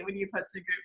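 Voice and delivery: ordinary talk, nothing marked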